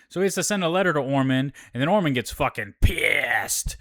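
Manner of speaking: ordinary talk, nothing marked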